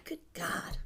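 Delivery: breathless whisper fighting tears; barely whispered, holding back tears; choked whisper. Real, not acted. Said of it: "trying not to cry".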